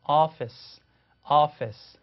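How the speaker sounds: office said clearly twice; teacher echoing office after the clip; model office. Shown as a demonstration. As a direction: repeat office firmly. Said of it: "해보세요".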